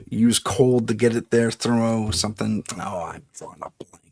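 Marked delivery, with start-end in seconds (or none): 2.8-4.0 muttering. Real, not acted. Forgets word.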